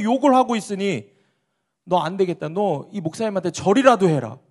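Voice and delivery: loud, high-pitched dressing-down; angry scolding. Acted. Policeman scolding the delivery man for blaming the pastor, shouting angrily. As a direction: build into a shouted rebuke. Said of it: "소리소리를 지르면서 호통을 치는 거예요".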